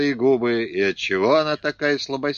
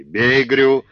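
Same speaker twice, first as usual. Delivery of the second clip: urgent shout; 3 semitones higher, 7 dB louder than usual. Acted. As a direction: shout the order. Urging brother during struggle.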